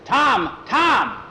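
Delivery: high, loud shout of a name; calling out. Acted. Jackie calling Tom as he walks in, shouting. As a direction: call him from across the house.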